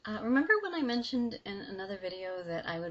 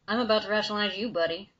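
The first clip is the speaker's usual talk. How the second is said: louder and fast, a threat with a grin; warning; 10 dB louder, much faster than usual. Acted. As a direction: fire the threat quickly at the demon. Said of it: "Billy warned".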